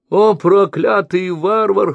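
high, loud, slow cursing cry; outcry. A narrator's reading. Merchant cries out at the barbarian, cursing. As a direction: curse him in a shrill howl. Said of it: "вскричал слепой".

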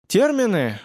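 high, puzzled one-word question; surprised echo. A narrator's reading. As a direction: repeat it, caught off guard. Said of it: "удивился Семён". (удивлённо, переспрашивая)

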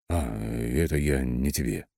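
slow, hesitant, slightly flustered dismissal; nervous brush-off. A narrator's reading. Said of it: "занервничав, отмахнулся я". (слегка нервно отмахиваясь)

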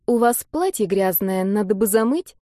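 ordinary delivery, nothing marked

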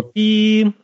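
ordinary talk

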